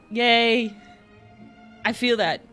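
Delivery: ordinary talk, nothing marked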